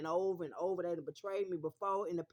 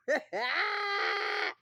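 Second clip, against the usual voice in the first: big high shrieking laugh; cracking up after calling them Dumb and Dumber, loud laugh; loud laugh burst; 12 semitones higher, 6 dB louder than usual. Real, not acted. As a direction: let a real laugh explode.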